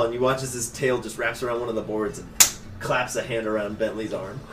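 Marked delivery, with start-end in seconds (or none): none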